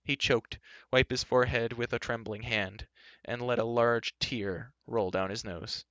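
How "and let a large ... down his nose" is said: usual speaking voice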